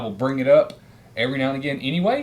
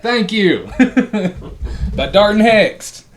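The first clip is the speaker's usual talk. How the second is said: laughing; 10 semitones higher, 9 dB louder, slower than usual. Real, not acted. Laughing relief finding book.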